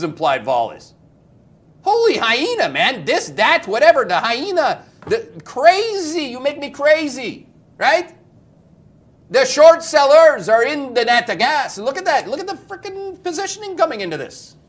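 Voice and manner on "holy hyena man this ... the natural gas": higher, loud, worked up; excited. Real, not acted. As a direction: get fired up over the chart. Excited about natural gas.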